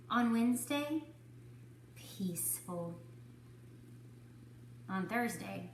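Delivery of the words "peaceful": soft, slow hush. A narrator's reading. Narrator softly savours the peace. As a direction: let it settle.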